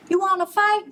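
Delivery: high, pushy challenge; high-pitched cocky bug; picking a fight. A narrator's reading. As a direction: squeak out a cocky dare. Grouchy ladybug picks a fight.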